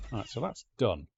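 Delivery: ordinary talk, nothing marked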